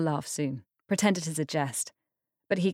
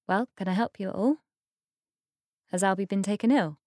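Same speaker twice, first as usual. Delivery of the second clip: quick polite query; 2 semitones higher, faster than usual. A narrator's reading. Iris asks politely, hurried.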